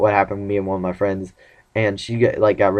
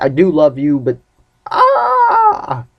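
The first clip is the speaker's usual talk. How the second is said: mock anguished cry; 6 semitones higher, 4 dB louder, much slower than usual. Retold confession ending in a cry.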